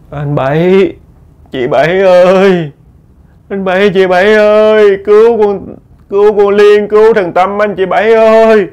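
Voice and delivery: high, loud wailing cry for help; a dead woman begging from outside the house; high loud pleading wail; desperate ghost calling. A narrator's reading. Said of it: "Kêu, kêu bằng um sùm ở ngoài".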